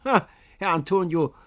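high, loud, gleeful outburst; hammed-up jolly Pietro boasting; excited boatman. A narrator's reading. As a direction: brag as you jump up. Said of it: "erwiderte Pietro, indem er hoch aufsprang".

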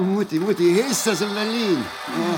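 excited cry. Real, not acted. Begeistert über [name] im Fernsehen. (aufgeregt und freudig, laut und hoch)

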